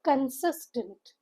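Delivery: Consistent said slowly, high tone on "sis", con-SIS-tent; slow stressed model. Shown as a demonstration. Teacher modelling stress with a rise and fall for students. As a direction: drag out Consistent and lift the middle syllable. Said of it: "You stress on the word with a high tone".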